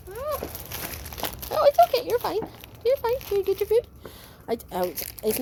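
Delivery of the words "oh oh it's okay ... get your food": high, soothing coo to the pup; calm her down with a cheerful lilt; reassuring pet talk. Real, not acted. Reassuring puppy.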